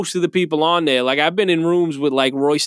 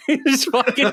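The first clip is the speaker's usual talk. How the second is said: high laughing through the words; giggling mid-sentence; real laughter; 10 semitones higher, slower than usual. Real, not acted. Laughing at his own bit.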